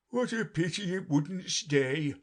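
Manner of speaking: slow, high regretful Lory; sighing. A narrator's reading. Lory watching the mouse leave, sighing. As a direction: draw it out with a regretful sigh. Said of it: "sighed the Lory".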